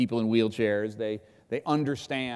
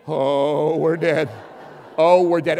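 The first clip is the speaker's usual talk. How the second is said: panicked; 5 semitones higher, 9 dB louder, slower than usual. Retold or imitated. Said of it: "cameraman's looking at me going".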